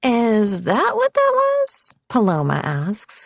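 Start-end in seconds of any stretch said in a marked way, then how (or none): 0.0-1.9 slow, high mock puzzlement; exaggerated puzzled question; feigned confusion. A narrator's reading. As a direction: ask it slowly, rising high in fake bafflement. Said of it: "feigning confusion".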